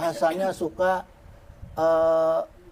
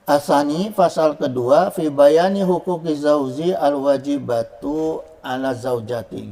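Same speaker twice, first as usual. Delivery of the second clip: recitation; 4 dB louder, slower than usual. Shown as a demonstration. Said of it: "reading Arabic chapter title".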